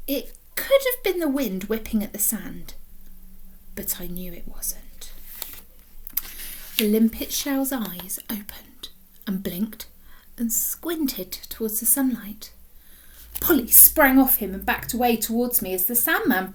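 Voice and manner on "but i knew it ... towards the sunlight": whispered, slow reveal; hushed. A narrator's reading. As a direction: whisper it as the magic happens. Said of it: "hushed reveal".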